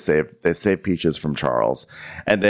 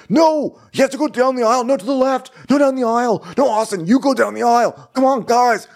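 much higher, louder panicked bossing; frantic Shep; 3 dB louder than usual. Retold or imitated. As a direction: bark directions in a high, frantic squeal. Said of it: "He's like".

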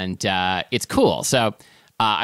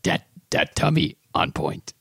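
halting monster voice, a little lower; Cookie Monster; 3 semitones lower than usual. Pretend. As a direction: play a cookie-loving puppet monster on request. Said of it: "like your Cookie Monster jukebox".

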